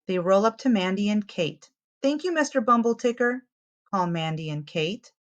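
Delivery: lively picture-book read-aloud; reading to children. A narrator's reading. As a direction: tell it to little ones, clearly and brightly.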